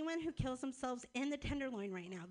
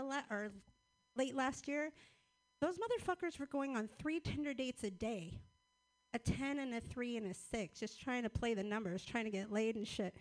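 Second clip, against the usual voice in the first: usual speaking voice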